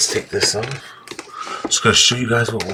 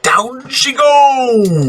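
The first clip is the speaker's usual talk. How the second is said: high, slow wailing cry; mock wail; 13 semitones higher, 4 dB louder, much slower than usual. Real, not acted. Noodles dropped on floor.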